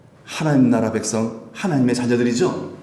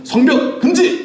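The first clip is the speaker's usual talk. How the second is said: high, louder royal command; king's decree; 6 dB louder, slower than usual. Retold or imitated. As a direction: proclaim a ban like a king, drawn out and high. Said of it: "아닥사스다 왕이". (왕이 명령하듯 높고 크게 외치는 금지령)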